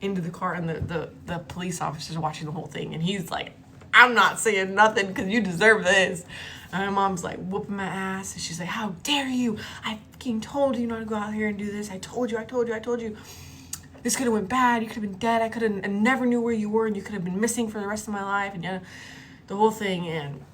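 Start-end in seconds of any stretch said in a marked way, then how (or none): 3.9-6.2 loud, emphatic officer voice; police officer. Retold or imitated. Officer watching mom punish daughter, emphatic mock voice. 8.7-18.5 higher, scolding mother impression; mock angry rant, short of a real scream; screaming mom. Mom scolds daughter after rescue, mock screaming rant. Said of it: "she's screaming".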